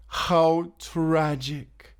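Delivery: mock pity. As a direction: drag it out, relishing her doom. Villain mocking captive's fate.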